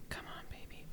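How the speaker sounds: breathy hopeful whisper; whispered plea. Real, not acted. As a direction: whisper to the dice. Whispers before the dice roll.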